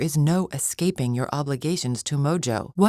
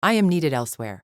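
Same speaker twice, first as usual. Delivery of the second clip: usual speaking voice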